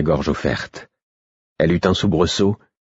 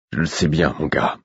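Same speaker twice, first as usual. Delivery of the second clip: sadly; 3 semitones lower than usual. A narrator's reading. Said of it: "répondit-il avec tristesse".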